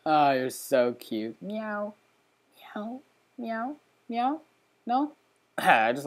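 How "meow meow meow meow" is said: high, soft cat meows; mock meow. Gamer meowing at his new cat, high and soft. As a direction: meow up at the kitty. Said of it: "cracked my voice there".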